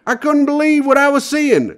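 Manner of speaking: very high, loud put-on winner voice; lottery winner impression. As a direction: squeal it like the amazed winner. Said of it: "the winner recalled".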